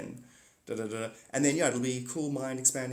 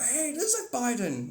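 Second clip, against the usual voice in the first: mock realization; 11 semitones higher than usual. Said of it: "then you're gonna go".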